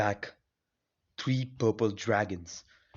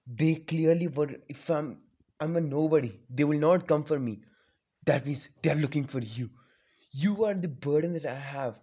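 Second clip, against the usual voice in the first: higher and louder, furious accusation; raging boy, higher and louder; angry outburst; 3 semitones higher, 3 dB louder than usual. Acted. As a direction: yell at the fox that cursed you. Naruto yells at Nine Tails.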